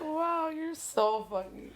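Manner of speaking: sarcastic. Retold or imitated. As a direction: lay the fake praise on thick. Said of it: "I'm like".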